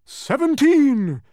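very high, loud, drawn-out yell; Humbug's triumphant shout; shouted answer. A narrator's reading. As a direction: shout it out at the top of your range. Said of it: "shouted the humbug".

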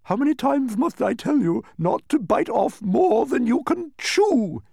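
loud, very high scolding; snapping undersecretary. A narrator's reading. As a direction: snap it out in a high, exasperated voice. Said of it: "snapped the undersecretary".

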